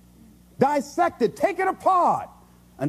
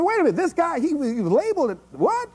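amazed onlooker; 6 semitones higher than usual. Retold or imitated. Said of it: "people who look at me and say".

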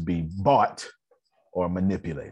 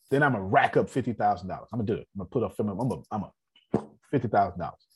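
ordinary talk, nothing marked

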